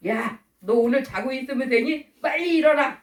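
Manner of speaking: scold, building to the command; mock scolding. God scolding a sleeper to get up, mock scolding. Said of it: "이렇게 막 혼내시는".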